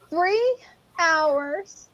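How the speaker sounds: very slow, stretched-out teasing offer; drawn-out haggling. Real, not acted. Bargaining with friend over sleep, slow and teasing. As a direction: draw out each part, softly, holding the suspense.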